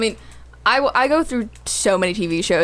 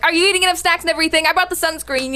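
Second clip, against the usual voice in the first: lightly voiced fussing mother, higher and quick; 7 semitones higher, faster than usual.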